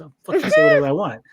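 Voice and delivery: loud, high, rushed imitation of a hothead; hammed-up angry retort; mock defiant outburst.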